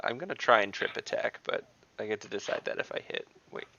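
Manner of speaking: ordinary talk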